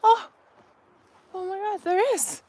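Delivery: high, falling gasp of mock wonder; playful amazement. Spotting tree blossoms after asking what if, playfully amazed. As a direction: marvel as if the wish came true. Feigned discovery of imagined blossoms.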